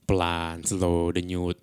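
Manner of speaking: slow, spaced-out words; unhurried, measured radio calm; slow DJ intonation. Shown as a demonstration. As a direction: draw each word out like a midnight DJ. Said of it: "intonasi D J tengah malam".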